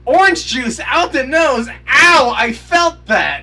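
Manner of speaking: high, loud pained yelp; sympathetic yelp. Real, not acted. Viewer wincing at juice out of the nose, pained yelp. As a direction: yell as if you felt it too.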